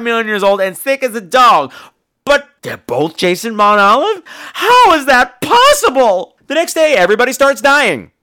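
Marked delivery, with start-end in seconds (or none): 4.5-6.4 slow, high, loud disbelief; incredulous. Acted. Storyteller baffled by two Jasons, incredulous. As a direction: stretch it out in loud mock amazement.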